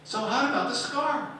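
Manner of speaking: put-on high, loud kids cutting in; impatient kids. Pupils interrupting the teacher's story, high and loud. Children pressing again.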